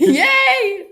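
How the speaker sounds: high, loud laughing whoop; gleeful cheer. Real, not acted. Co-host cheering about the popsicles, high and gleeful. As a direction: cheer it out at full volume.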